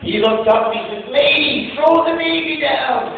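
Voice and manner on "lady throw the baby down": high-pitched drawn-out mock holler; calling up. Receiver to woman atop burning building, hollering up. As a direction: call it slowly to someone far above.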